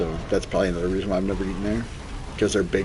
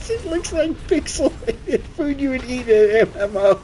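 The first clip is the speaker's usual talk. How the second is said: high mocking voice; lightly high mock disgust; imitating Chisel. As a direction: sneer at it in a high squeak. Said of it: "Chisel goes".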